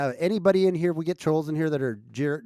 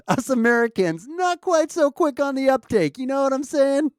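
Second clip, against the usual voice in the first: very high, loud silly voice; mock American voice; 12 semitones higher, 7 dB louder than usual. Mocks slow-witted Americans after getting joke.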